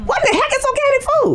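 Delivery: high, disbelieving question; incredulous. Retold or imitated. She first hears of organic food, high disbelief. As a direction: sound baffled by it.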